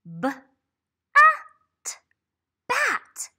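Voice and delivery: B, at stretched then bat popped high; sounding out bat. Shown as a demonstration. Teacher sounding out bat for a child. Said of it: "sounding out a word".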